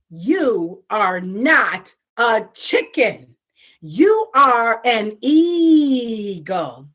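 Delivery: each word slow and weighty; slow, grave words to the little eagle; slow solemn declaration. Acted. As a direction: proclaim it slowly, like a solemn truth.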